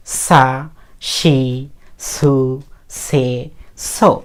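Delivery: kana syllables drawn out one by one; teacher sounding out the Japanese s-row; model recitation. Shown as a demonstration. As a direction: say সা, শি, সু, সে, সো as separate, long-held syllables. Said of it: "এভাবে পড়বেন".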